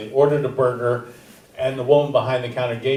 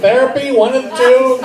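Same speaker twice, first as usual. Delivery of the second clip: laughing; 10 semitones higher, 6 dB louder than usual. Real, not acted. Laughing joke after.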